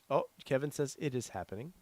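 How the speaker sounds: ordinary talk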